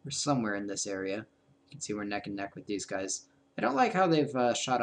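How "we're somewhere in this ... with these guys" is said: usual speaking voice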